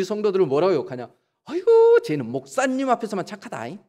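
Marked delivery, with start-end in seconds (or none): 1.4-3.8 mock a tutting churchgoer; gossiping church member. Churchgoer gossiping about a fellow member, high exaggerated sigh. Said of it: "그렇게 얘기해요".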